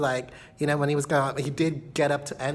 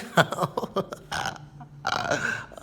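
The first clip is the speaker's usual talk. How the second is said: breathy giggle; 4 semitones higher than usual. Real, not acted. Giggling to viewers about wanting him over. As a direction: giggle at your own naughty thought. Giggles after wishing he were here.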